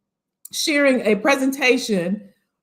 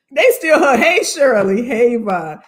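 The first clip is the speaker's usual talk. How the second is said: ordinary talk, nothing marked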